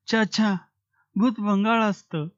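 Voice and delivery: put-on high, child-like refusal; a frightened boy saying no; child's voice. A narrator's reading. Child answers scared. (लहान मुलाचा उंच घाबरलेला नकार)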